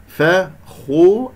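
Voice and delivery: slow فخو with emphatic kh and rounded u; heavy rounded khaa. Shown as a demonstration. Teacher showing the khaa, slow rounded فخو. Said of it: "الخاء مفخمة مرتبة ثالثة لأنها مضمومة".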